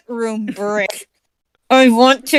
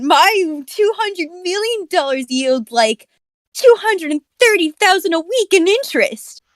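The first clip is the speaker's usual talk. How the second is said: smug rich guy voice; 7 semitones higher than usual. Acted. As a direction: brag like a pompous millionaire. Reading rich man role.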